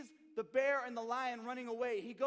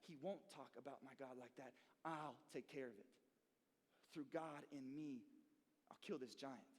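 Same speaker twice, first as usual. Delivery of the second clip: quiet conviction; 9 semitones lower, 11 dB quieter than usual. Retold or imitated. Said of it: "he says".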